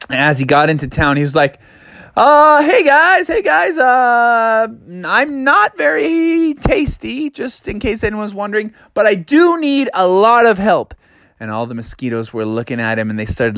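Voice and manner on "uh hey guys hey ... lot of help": high, stretched-out hesitant voice; nervous Froggy. A narrator's reading. Froggy greeting hungry mosquitoes, high and wary. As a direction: tread carefully in a high cartoon pitch.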